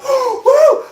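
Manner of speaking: gasp, then high, loud cheer; jubilant whoop. Retold or imitated. Kid thrilled his buddy defended him, loud whoop. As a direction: whoop like your side just won. Re-enacted celebration of rescue.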